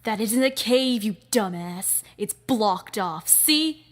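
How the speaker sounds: loud, contemptuous put-down; scornful boss. Acted. Boss snapping at the dumbass henchman, loud scorn. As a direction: scold the dumb lackey.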